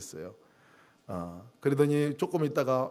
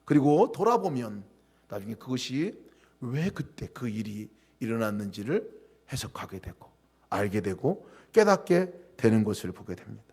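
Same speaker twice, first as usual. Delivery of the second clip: ordinary talk, nothing marked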